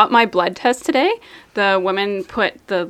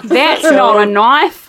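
louder, slow Australian drawl; Australian accent; 2 semitones higher, 6 dB louder, much slower than usual. Acted. Having a go at an Australian accent, loud and drawn-out. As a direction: belt out a broad Australian accent. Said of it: "an Australian accent".

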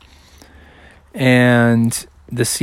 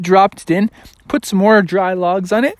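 plain reading voice

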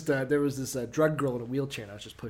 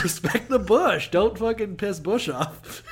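mock solemn warning; 5 semitones higher, 3 dB louder, slower than usual. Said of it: "joking repeat".

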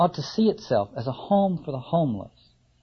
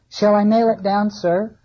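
higher, louder eager carpenter; carpenter asking; 5 semitones higher, 5 dB louder than usual. Acted. Carpenter asking Ahab about the lid, eager and loud. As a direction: ask the captain keenly, hammer in hand.

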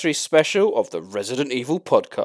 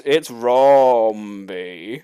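drawn-out announcement; 2 semitones lower, much slower than usual. Pretend. Dramatic slow guest intro.

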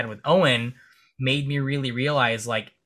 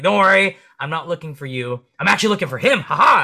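high, loud mock reassurance; playful teasing; 4 semitones higher, 7 dB louder than usual. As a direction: tease them, landing the joke loudly. Acts out teasing cop's joke.

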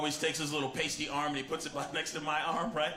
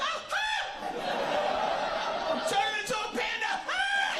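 very high, hammed-up shriek of terror; squealing mock horror; panicked scream; much slower than usual. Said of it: "You'd be so scared".

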